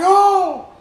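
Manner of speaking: loud, high "oh!"; hammed-up gasping outcry; shocked cry. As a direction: cry out as if watching someone die. Acts out shock at the death.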